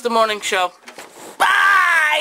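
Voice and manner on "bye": loud. Real, not acted.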